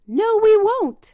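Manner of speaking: high, loud piglet refusal; little pigs' voice. A narrator's reading. Pigs answering the wolf from inside, high defiant shout. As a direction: shout back in a high piggy voice.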